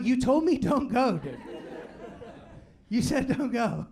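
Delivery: slow, emphatic, laughing exasperation; laughing protest. Retold or imitated. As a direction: remind dad what he really said. Arguing with dad, laughing.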